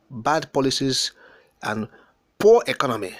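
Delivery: normal talking voice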